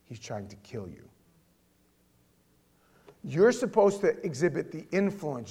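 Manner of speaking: ordinary talk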